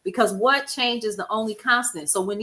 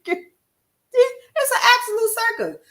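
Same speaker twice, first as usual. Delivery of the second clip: exaggerated disbelief; 12 semitones higher, 3 dB louder, slower than usual. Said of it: "I was like".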